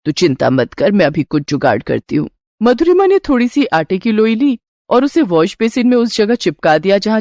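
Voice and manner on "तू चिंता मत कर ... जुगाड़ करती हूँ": put-on lower-pitched, reassuring older woman of the house; calm her worries in a low mother-in-law voice; resourceful mother-in-law, pitched down.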